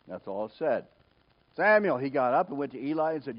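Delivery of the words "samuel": short, loud, high name call; acted call, raised voice. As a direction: summon the boy by name.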